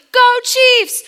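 shouted high and loud like a fan in the stands; sports cheer. Retold or imitated. Preacher mimics a stadium fan, loud cheer. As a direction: cheer it like the home team just scored.

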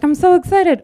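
high, loud gushing excitement; a hammed-up burst of excitement; mock excited. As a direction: squeal it like a giddy kid. Retelling her own excitement.

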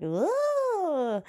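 long, high, loud whoop imitating herself; loud worked-up noise. Shown as a demonstration. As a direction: let out a sustained high-pitched cry of excitement. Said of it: "my voice gets very loud".

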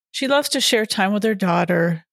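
ordinary talk

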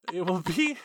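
high laughter through the words; laughing too hard to finish; breaking up laughing. Real, not acted. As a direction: crack up while trying to read. Cracks up mid-quote.

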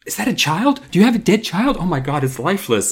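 high-pitched horrified questions; hammed-up alarm, voice pushed high; mock panic. [name] asking about a dead child, mock panic.

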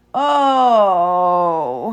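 drawn-out sick groan; a hammed-up bellyache moan. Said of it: "he moaned".

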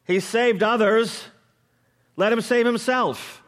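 high, loud mocking jeer; scoffing rulers. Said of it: "the rulers scoffed at him".

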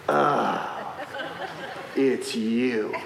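low, flat, unimpressed "it's you"; cold recognition. Retold or imitated. Woman at the gas station recognizing her old pastor, cold and low. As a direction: drop the pitch, disgusted to see him.

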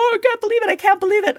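elated disbelief. Retold or imitated. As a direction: squeal it out like lottery winners. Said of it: "holding each other and they're going".

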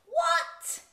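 loud, high yelp of surprise; shocked disbelief. Acted. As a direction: burst out in comic shock. Shocked after the robot lines.

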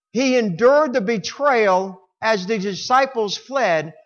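loud, high-pitched sermon delivery; full-force preaching. Real, not acted. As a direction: proclaim it to the whole congregation.

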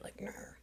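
breathy whispered mock growl; a tiny whispered monster roar; whispered rawr.

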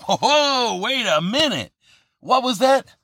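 teasing double take; mock surprise. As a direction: act like you misheard him on purpose. Dad hears son admit he's right.

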